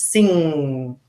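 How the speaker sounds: "sing" ends on a ringing ng with no hard g; 把"sing"结尾的ng说清楚，不带g; ng ending. Shown as a demonstration. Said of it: "Say the word sing".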